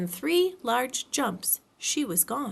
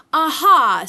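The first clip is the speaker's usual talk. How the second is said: loud forced Aha; loud anxious Aha; 5 semitones higher, 13 dB louder than usual. A narrator's reading. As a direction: bluff while nervous. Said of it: "looking around anxiously for the others".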